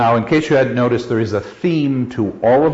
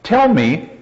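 ordinary talk

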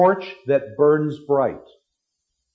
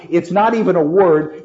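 ordinary delivery

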